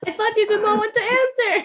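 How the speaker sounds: loud, high, rushed burst through laughter; laughing protest. Real, not acted. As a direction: blurt it out fast, high and amused. Teasing protest amid shared laughter.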